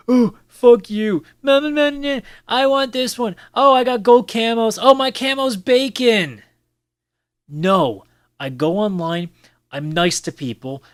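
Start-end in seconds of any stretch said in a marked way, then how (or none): 0.1-6.4 high, loud whiny gamer rant; mock whiny gamer. Gamer mocking toxic players in a high, loud whine. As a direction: screech it like a spoiled kid online. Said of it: "I don't try to spend it going around".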